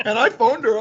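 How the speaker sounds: ordinary talk, nothing marked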